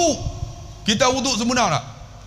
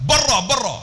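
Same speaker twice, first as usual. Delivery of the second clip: high, sharp mock-angry order; 6 semitones higher, 3 dB louder than usual. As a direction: order them out, mimicking anger.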